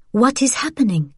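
sharp demand. Acted. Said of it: "Vianne said sharply".

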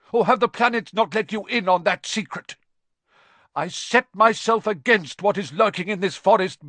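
defiant centaur, high and loud; impassioned shouted rebuke; furious bellow. A narrator's reading. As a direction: bellow it with righteous anger. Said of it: "Firenze bellowed at Bane".

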